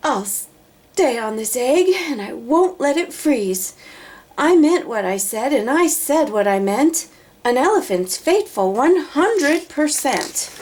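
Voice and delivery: a touch of a stubborn elephant's vow; determined elephant. Acted. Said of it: "said with a sneeze".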